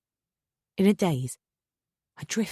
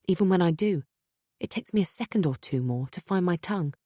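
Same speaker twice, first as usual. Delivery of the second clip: plain reading voice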